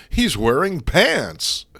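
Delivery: loud, high, drawn-out disbelief; mock astonishment. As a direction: exclaim it slowly, hammed-up and incredulous. Mock astonishment at flimsy disguise.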